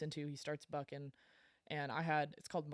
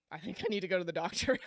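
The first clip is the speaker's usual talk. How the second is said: rushed admission; 3 semitones higher, 5 dB louder, faster than usual. Retold or imitated. Finally admitting he needs help.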